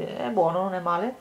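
ordinary delivery

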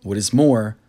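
plain reading voice

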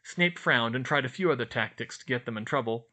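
usual speaking voice

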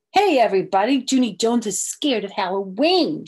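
loud tattling shout to the class. Acted. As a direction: announce it loudly to the whole room. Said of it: "she shouted".

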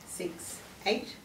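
ordinary talk, nothing marked

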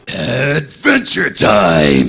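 slow gravelly roar, hold each word; Wolverine voice. Pretend. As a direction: growl it out like Wolverine. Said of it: "say Adventure Time in your Wolverine voice".

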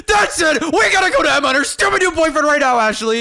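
high, jealous outburst; worked up. Acted. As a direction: burst out, fed up and demanding action. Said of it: "Why are you so worked up?".

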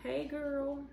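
chewing while talking; muffled by food; mouth full. Real, not acted. Seen 0: Closed moving mouth early, food held near lips; talks openly later.

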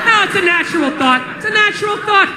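usual speaking voice

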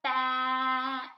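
teacher modelling sounds slowly; stretched sounds. Shown as a demonstration. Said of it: "say a word very slowly so you can hear each sound".

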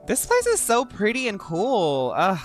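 ordinary talk, nothing marked

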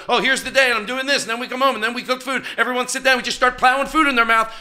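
very high, loud singsong parody; mocking himself. Mocking his own careless habits, a high loud parody. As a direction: parody the mindless routine in a squeaky voice. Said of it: "Is that what you're gonna do?".

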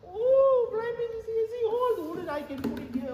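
very high, loud exclamation; exaggerated astonishment. Telling viewers about the wooden collection, exaggerated astonishment. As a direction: cry out in a big high-pitched "oh". Overwhelmed by all the wood.

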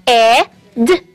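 breathy h, then "e", then "d"; sounding out head. Shown as a demonstration. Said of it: "Those sounds are".

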